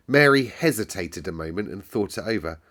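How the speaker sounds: ordinary delivery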